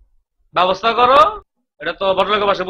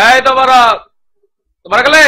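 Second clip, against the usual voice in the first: raised, louder summoning call; calling out loudly; 4 semitones higher, 5 dB louder than usual. Acted. Owner summoning his servants, calling out impatiently. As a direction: call sharply to someone out of sight.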